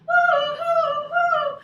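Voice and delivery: high, loud sung wind hoo; howling wind. Acted. Story teacher to kids, howling wind noise. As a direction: howl like the snowstorm.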